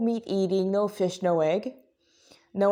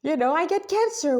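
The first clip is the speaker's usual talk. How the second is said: high, whiny mock protest; sarcastic imitation of a defensive critic; mocking objector; 8 semitones higher than usual. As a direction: parody the critic, pitched up high. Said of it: "before you start raging on and on about how".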